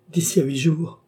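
high-pitched exclamation; exclaiming. A narrator's reading. Woman promising the prisoner a rescue in time, exclaiming. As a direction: cry it out with sudden resolve. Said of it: "s'écria Baccarat".

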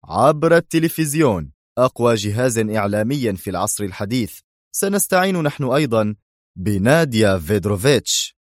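ordinary delivery

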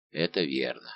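low, self-satisfied agreement. A narrator's reading. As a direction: agree contentedly, pitched well down. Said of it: "с выражением удовлетворения".

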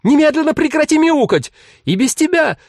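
very high, loud scolding; mother's outburst at the end of patience; exasperated yell. A narrator's reading. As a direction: shriek at the child to stop. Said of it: "и она закричала".

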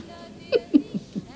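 kurzes, hohes Auflachen. Acted. Lachen nach spöttischer Frage.

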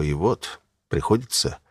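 plain reading voice

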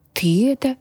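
high-pitched snort of a small dragon. A narrator's reading. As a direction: snort it, lightly voiced as a dragon. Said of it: "фыркнул дракон". (фыркая, голосом дракончика)

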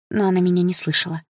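ordinary delivery, nothing marked

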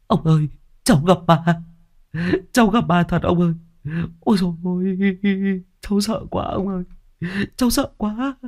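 put-on high, whimpering child's voice; a scared little boy crying to his neighbour; terrified crying boy. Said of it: "vừa khóc vừa nói".

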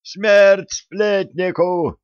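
high, slow, loud bellow; mob shout. Acted. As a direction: scream for his death.

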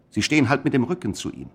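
normal talking voice

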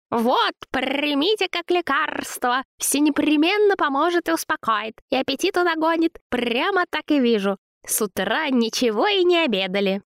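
very high, cooing flattery; babbling, fussing woman. Acted. Zina soothes angry Vova, high fawning babble. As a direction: coo and fuss to soothe him.

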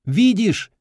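high shout across the mound; shouting over wind. Acted. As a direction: call out far away. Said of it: "крикнул".

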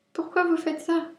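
high, plaintive little rabbit moan; high-pitched wail of complaint; whining. A narrator's reading. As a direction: whine it in a small high voice. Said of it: "gémit Lapin".

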